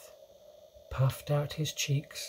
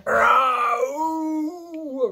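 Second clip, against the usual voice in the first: loud, high drawn-out howl; roar; 14 semitones higher, 7 dB louder than usual. A narrator's reading. Sleeping giant roars in his nightmare, loud high howl. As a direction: roar out at full voice. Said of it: "roared the flesh lump eater".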